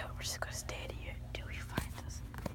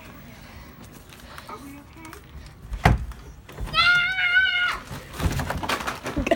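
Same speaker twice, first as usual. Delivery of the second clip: shrieking laugh; loud laughter; 16 dB louder than usual. Real, not acted. Found hider, laughing.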